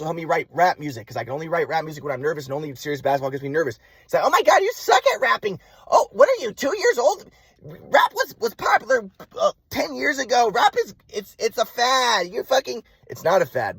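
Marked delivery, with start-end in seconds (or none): 4.2-12.8 mock rant starting very high, slowly settling; scornful mother imitation. Mom dismissing son's rap music, high scornful mock rant. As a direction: mimic mom's high, scornful tirade easing down. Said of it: "But I was telling her".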